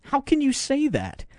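high, soft disbelieving question; incredulous. Real, not acted. Host reacts to the president's played remark, incredulous. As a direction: ask it high-pitched, in disbelief.

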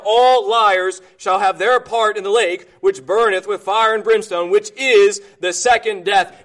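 loud, driving scripture reading; preacher pounding out scripture; fiery preaching. Acted. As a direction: thunder the verse at the congregation. Preaching scripture at full force.

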